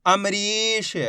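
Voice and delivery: अमरीश with a long, held री; teacher drawing out अमरीश; blended slow reading. Shown as a demonstration. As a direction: join अमरीश slowly, stretching the ee. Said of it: "एक ही अक्षर का उच्चारण सही से".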